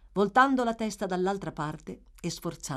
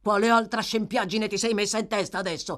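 savage tirade building to shouts; 5 semitones higher, 4 dB louder than usual. A narrator's reading. Brute orders the weakened girl up, savage scolding. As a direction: berate her, louder on the orders. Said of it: "con una voce selvaggia".

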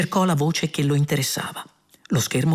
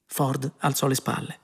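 usual speaking voice

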